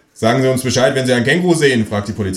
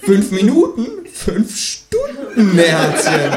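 high, slow, mocking drawl; mocking kangaroo; 11 semitones higher, much slower than usual. A narrator's reading. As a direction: stretch it out to mock the caller. Känguru figure voiced high.

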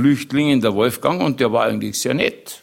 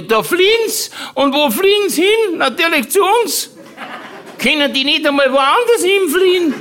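very high, mimicked aunt's rant; Tante Mari; 3 dB louder than usual. Comedian imitating his aunt on fleeing refugees, very high mock indignation. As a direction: send up an outraged aunt in a squawky high pitch. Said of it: "weil die Tante Mari gesagt hatte".

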